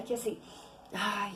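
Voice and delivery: ordinary talk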